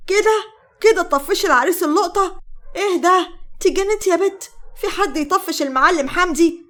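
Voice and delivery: high, indignant scolding; angry scolding. A narrator's reading. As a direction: berate her for driving away the suitor. Scolding her, throwing her out. (بتوبيخ غاضب)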